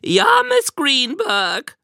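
high, squeaky mocking voice; slow falsetto mimicry of a girl; Owen. A narrator's reading. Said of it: "mit hoher, quietschiger Stimme".

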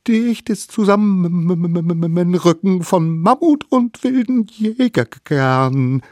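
teeth chattering with cold. A narrator's reading. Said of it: "Mit klappernden Zähnen sagte er".

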